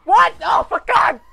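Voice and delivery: high loud outraged yell; exasperated shout. Real, not acted. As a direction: cry out in disbelief. Hit by car.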